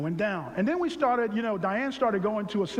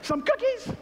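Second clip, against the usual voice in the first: excited craving; 11 semitones higher than usual. Acted. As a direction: call out each treat like a hymn. Craving food, preacher-like.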